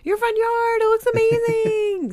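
high squealing delight; excited gushing. Retold or imitated. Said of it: "they were like".

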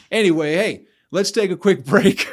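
laughing break call. Real, not acted. Host pivots to sponsor break.

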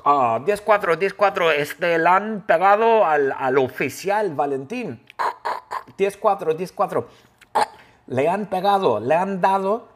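hammed-up police radio report; walkie-talkie call. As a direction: imitate an officer calling in over a walkie-talkie. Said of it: "él agarra su walkie-talkie y él llama".

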